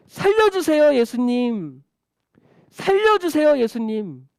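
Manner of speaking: high, loud cry for help. Acted. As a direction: call out pleadingly, falling on the name. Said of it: "소리 지르는 거야".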